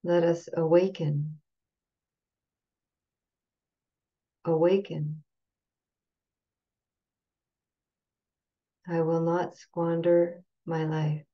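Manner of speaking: slow call-and-repeat gatha, long pauses between phrases; measured, phrase-by-phrase chant leader, unsung; slow recitation. Shown as a demonstration. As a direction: recite slowly, leaving room to repeat after each phrase. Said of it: "I'll go say it slowly".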